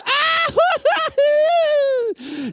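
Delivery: very high exaggerated wail; hammed-up howl breaking into high hoots; mock bawling. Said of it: "he completely lost it now".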